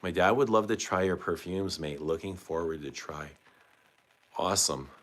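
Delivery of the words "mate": usual speaking voice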